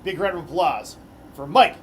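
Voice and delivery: arena driver introductions; announcer. Acted. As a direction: hype each name for the crowd.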